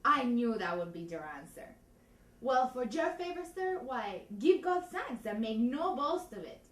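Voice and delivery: put-on loud, theatrical Spanish accent with feeling; Spanish accent, as a woman. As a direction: play it big in a comic Spanish accent. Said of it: "Do it in Spanish again".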